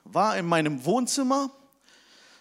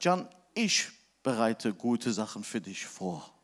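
much lower and softer, tender promise; gentle voice of God; 11 semitones lower, 5 dB quieter than usual. Retold or imitated. God speaking tenderly to the pastor, low and calm. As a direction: speak as God reassuring softly.

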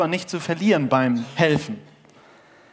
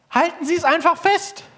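hoch und laut von unten gerufen; Fremder am Seil; 3 dB louder than usual. Acted. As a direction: ruf eindringlich nach oben. Fremder ruft vom Seil. (eindringlich gerufen, hoch)

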